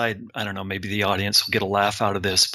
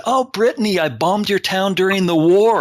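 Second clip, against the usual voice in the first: put-on old veteran quoted in a much higher, louder, cheerful voice; old timer; 8 semitones higher, 4 dB louder than usual. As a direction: breezily boast about the bombing as the old man. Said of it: "And the old timer said".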